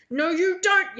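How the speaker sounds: loud, high sharp yell. A narrator's reading. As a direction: shout to stop him grabbing. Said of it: "yells Bibby".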